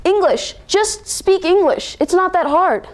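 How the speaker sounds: high-pitched, drawn-out exasperation; fed up and scolding; frustrated outburst. Acted. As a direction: lose patience, stretching each word. Son's demand peaks in frustration.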